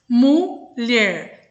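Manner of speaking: "Mulher" broken slowly as mu, lher; split into two syllables. Shown as a demonstration. Teacher counts syllables for pupils, "Mulher" split in two. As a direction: say "Mulher" in two separate beats. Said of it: "também falo duas vezes".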